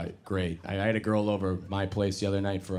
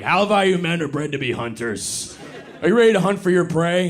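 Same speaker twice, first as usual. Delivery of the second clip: mock alpha male; 5 semitones higher, 7 dB louder than usual. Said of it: "being like".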